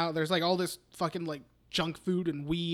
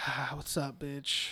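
low, quiet deflated hello; unhappy greeting; 5 semitones lower, 8 dB quieter, slower than usual. Retold or imitated. Boyfriend unhappy that she's there, deflated greeting. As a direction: sigh it out low and soft, unwelcoming. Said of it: "Obviously not happy that she's there".

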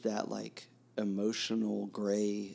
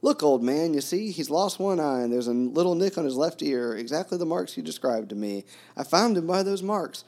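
loud, higher, eager proud announcing; ringing happy voice; 7 semitones higher, 8 dB louder than usual. Retold or imitated. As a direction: show off the found dog, thrilled. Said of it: "in a ringing, happy voice".

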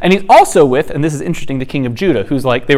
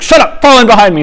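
higher, brusque mock order; retold commander's yell; 6 semitones higher, 4 dB louder than usual. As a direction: act out a commander cutting off the messenger. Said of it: "He yelled at him".

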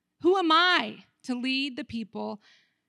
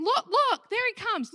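excited; 10 semitones higher, 4 dB louder than usual. Acted. God voiced, pointing out Aaron.

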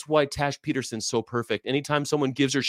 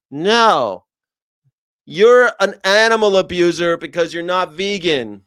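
louder, flat-out blunt; loud blunt declaration; 6 dB louder than usual. Acted. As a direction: slam it down with no hedging. Blunt counter to hedging.

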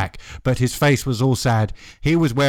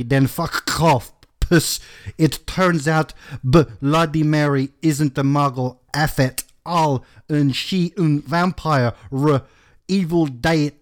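exaggerated over-enunciated read of a ranty note; put-on author's-note rant; 3 semitones higher than usual. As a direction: sound out each garbled word crossly.

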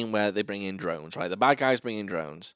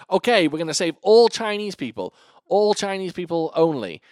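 mock announcement; 8 semitones higher, 6 dB louder than usual. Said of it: "guy goes".

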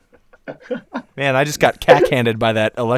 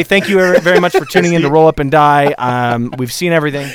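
usual speaking voice

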